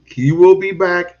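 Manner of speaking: ordinary talk, nothing marked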